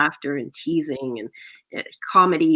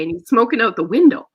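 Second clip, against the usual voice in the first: usual speaking voice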